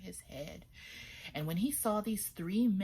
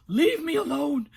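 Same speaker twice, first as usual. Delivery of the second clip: high, loud yam voice; talking yam voice; 8 semitones higher, 12 dB louder than usual. A narrator's reading. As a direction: snap it like a grumpy yam.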